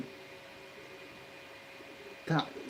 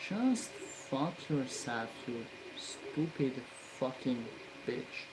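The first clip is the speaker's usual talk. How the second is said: muttered curse; 2 semitones lower, 10 dB quieter than usual. Real, not acted. Muttering at the part.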